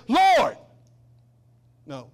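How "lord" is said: loud, high acted cry of protest; mad outcry. Martha marching up to Jesus, angry outcry. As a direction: call Him out in mock indignation. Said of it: "she gets so mad".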